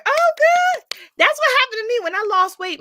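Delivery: high, loud delighted exclaim. Real, not acted. Host thrilled by a viewer's doctor news, high and loud. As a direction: burst out with joy.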